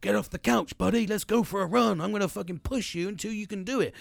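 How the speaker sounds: imagined friend pushing James to run; pushy workout buddy. Pretend. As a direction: chivvy James off the couch. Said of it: "no one's gonna go".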